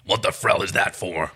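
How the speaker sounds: slow, puzzled warrior voice; a warrior slowly questioning a strange toy; Dargo character. Acted. Dargo looks at the toy badge, slow and puzzled.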